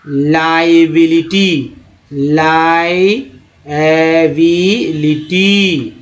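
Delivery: Liability dragged out very slowly, syllable by syllable; over-articulate liability for students; teacher's slow demo; slow model word. Shown as a demonstration. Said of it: "teacher models word slowly".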